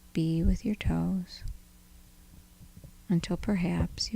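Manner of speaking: soft, unhurried body-scan voice with long pauses; guided meditation. Acted. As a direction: gently lead them through the body, leaving space between phrases.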